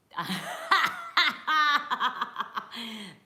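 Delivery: big high burst of laughter; loud laugh. Real, not acted. Streamer laughing hard at a viewer's joke, loud cackle. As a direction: crack up out loud at the chat. Reacting to a funny comment.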